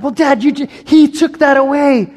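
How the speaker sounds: put-on high, pleading kid voice protesting to dad; high child's complaint, tattling on a sibling; whiny child. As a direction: play the kid caught out, whining an excuse.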